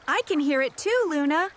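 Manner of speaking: usual speaking voice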